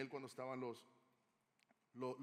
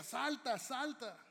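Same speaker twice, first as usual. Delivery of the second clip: urgent yell, much higher and louder; firefighter shouting; 8 semitones higher, 6 dB louder than usual. Retold or imitated. Firefighter yelling to a blind girl trapped by fire, urgent shout. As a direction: shout up to a girl in a burning building. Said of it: "escuchó la voz de un bombero gritar".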